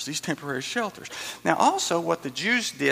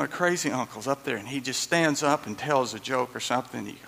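normal talking voice

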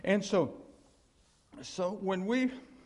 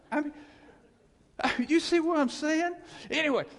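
laughing; 9 semitones higher, 5 dB louder than usual. Real, not acted. Laughing at his own joke.

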